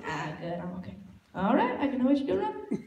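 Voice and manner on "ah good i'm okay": much softer, lower, waving him off; mumbled brush-off. Retold or imitated. Brushing off the know-it-all golfer, much softer. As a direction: decline it under your breath. Declining advice, muttered.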